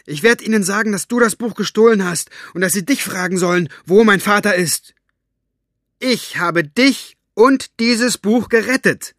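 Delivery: loud, high, furious accusation; agitated outburst. Acted. Girl shouting accusations at her aunt. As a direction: hurl it at her, slowing at the end.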